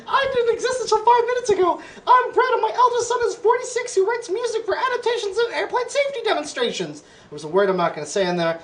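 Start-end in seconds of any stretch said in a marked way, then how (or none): 0.1-7.0 shouting bread. Acted. Bread keeps talking to boy.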